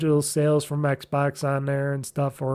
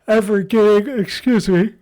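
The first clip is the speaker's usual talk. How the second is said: words pulled long by a yawn; 5 semitones higher, 6 dB louder than usual. Real, not acted. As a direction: talk through a yawn. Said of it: "I should do a pause when I yawn".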